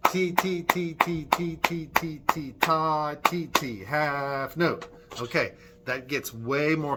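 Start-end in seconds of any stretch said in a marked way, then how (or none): 0.1-4.9 chanted ti and ta beats; teacher demonstrating a rhythm for children; rhythm demo. Shown as a demonstration. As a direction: chant a rhythm for them to copy. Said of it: "Try that".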